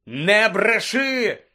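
long, drawn-out angry bellow; outraged high roar; furious yell. Acted. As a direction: shout it slowly at full force. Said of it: "заволав Блек".

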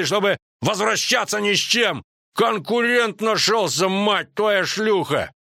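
high-pitched indignant tirade; Крахенкрайт ranting. A narrator's reading. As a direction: rage at a rival before the hall. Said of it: "неожиданно рявкнул Крахенкрайт".

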